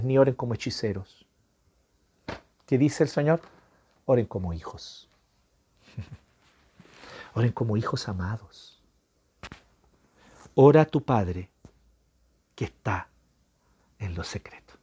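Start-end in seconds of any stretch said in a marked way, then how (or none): 4.1-8.8 tender. Real, not acted. Tender call to pray. 14.0-14.7 breathy whisper, hushed and intimate. Real, not acted. Preacher to listener, whispering about the secret place.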